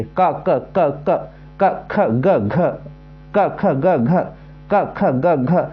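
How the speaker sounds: क, ख, ग, घ each said crisply from the throat, one by one; slow teacher drill of क ख ग घ; reciting the ka row. Shown as a demonstration. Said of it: "अब का बोल के देखिए".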